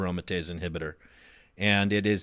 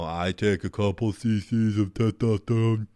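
imitating a lying gym bro. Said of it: "imitate their voice like that".

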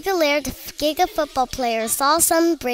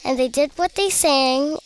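plain reading voice

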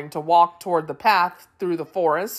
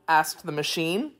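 usual speaking voice

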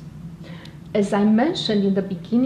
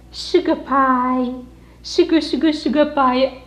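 higher sing-song pet talk; cooing to dog; 4 semitones higher than usual. Real, not acted. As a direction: croon the nicknames to the puppy.

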